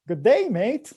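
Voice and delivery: amateur stab at an Australian accent; attempted Australian accent. Shown as a demonstration. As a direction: try a hearty Australian greeting. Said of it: "an Australian accent".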